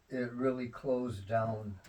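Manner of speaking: normal talking voice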